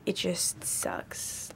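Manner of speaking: breathy, near-silent whisper; whispered dejection. Real, not acted. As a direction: let it fall away to a whisper. Upset recalling stolen bag.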